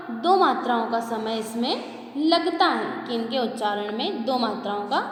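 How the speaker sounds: ordinary delivery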